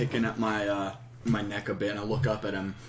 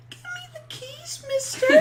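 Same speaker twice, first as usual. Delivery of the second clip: very high put-on child voice; tiny kid making a sinister demand, rising in volume; little kid's voice. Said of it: "in the little kid's voice say".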